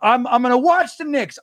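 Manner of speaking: high, fired-up self-quote; imitating his own hyped decision to watch the game, high; mock eager. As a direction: talk yourself into it, pumped. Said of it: "I'm like".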